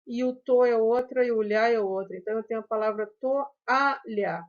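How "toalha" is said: "toalha" broken into to, a, lha; syllable-by-syllable "toalha"; split into syllables. Shown as a demonstration. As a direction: pause round the a in "toalha". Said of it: "um hiato".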